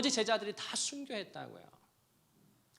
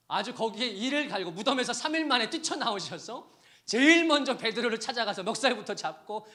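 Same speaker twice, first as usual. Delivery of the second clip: loud, high fervent preaching; preaching at full force; 6 semitones higher, 10 dB louder than usual. Acted. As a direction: drive it home to the congregation. (목청 높여 열정적으로 설교하듯)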